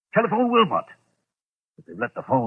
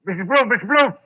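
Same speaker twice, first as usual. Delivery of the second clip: frantic call; 7 semitones higher, 5 dB louder than usual. Acted. Calls housekeeper in terror.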